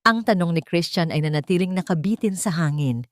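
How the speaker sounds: ordinary delivery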